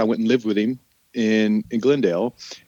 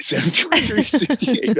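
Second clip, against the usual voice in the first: higher, laughing through the date; laughing repeat; 12 semitones higher than usual. Retold or imitated. Repeating the fake birthday to his buddy, giggling higher. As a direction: crack up while repeating the numbers.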